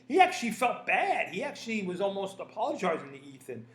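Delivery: ordinary talk, nothing marked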